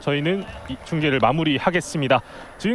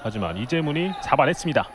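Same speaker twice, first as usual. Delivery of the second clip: ordinary talk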